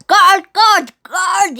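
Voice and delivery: loud high calls for the guard; captain calls his guard; cried out. Acted. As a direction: yell for the guard. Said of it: "he cried".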